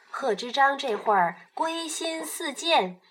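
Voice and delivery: usual speaking voice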